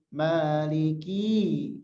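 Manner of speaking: "maliki" very slow, with the "ki" wrongly drawn out long; "maliki" with an overlong final "ki"; stretched ki. Shown as a demonstration. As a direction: show the error of lengthening "ki" in "maliki". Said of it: "kinya dipanjangin".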